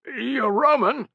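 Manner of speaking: high, slow, puzzled; confused. A narrator's reading. Sentry confused by the shouted reply. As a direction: ask in disbelief. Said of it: "came the confused reply".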